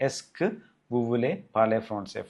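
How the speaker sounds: very slow, word by word; slow French model. Shown as a demonstration. Teacher modelling the formal question slowly for students.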